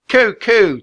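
high, loud cooing; loud dove call. A narrator's reading. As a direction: imitate a dove at full voice. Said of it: "Teddy said loudly".

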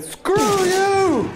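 high, drawn-out angry yell; slow, high-pitched play fury; mock drunken outburst. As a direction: wail it out like an offended drunk. Picking a fight in bar game.

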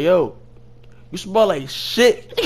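high, loud, slow punchline; laughing retold insult. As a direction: push the mock insult out through laughter. Punchline told while cracking up.

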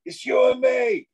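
high, loud call across a big room; shouting across the gym. Retold or imitated. Lifter calling to the only other person in the gym, shouted high. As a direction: yell it so she hears you from the far wall. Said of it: "remember shouting across the gym saying".

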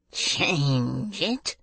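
drawn-out incredulous echo, rising; exasperated. A narrator's reading. Old man echoing the girl's taunt, exasperated. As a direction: stretch it out in rising outrage.

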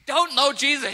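high, shouted denial; hammed-up furious shouting; angry outburst. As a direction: yell it as if losing it with a child. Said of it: "Peter's now swearing at children".

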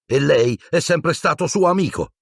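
high, anguished outcry; yelled in rage. A narrator's reading. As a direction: cry out betrayed, pitched high. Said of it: "urlò a Lupin".

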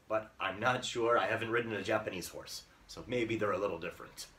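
ordinary talk, nothing marked

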